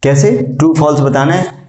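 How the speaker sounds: ordinary talk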